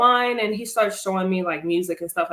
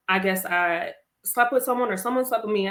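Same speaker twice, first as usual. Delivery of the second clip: usual speaking voice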